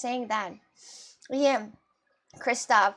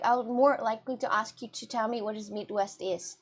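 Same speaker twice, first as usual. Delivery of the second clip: usual speaking voice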